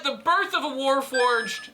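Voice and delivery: slow, high grand announcement; dramatic announcement. Acted. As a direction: announce it like an epic title.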